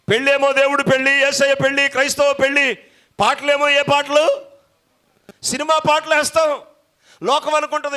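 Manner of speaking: high, loud pulpit shouting; fiery preaching. Acted. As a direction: preach it with full force. Preaching at full force.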